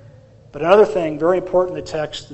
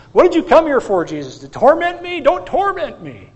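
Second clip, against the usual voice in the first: high, loud acted protest; hammed-up demon's plea; desperate pleading; 9 semitones higher, 4 dB louder than usual. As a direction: plead at a high pitch, in dread of torment. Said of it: "fell down on his face".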